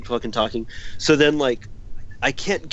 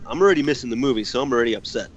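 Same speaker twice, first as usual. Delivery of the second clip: ordinary talk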